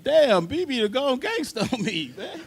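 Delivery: high, laughing amazement; cracking up in disbelief. Retold or imitated. Drummer laughing at his boss turning gangster. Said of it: "I was like".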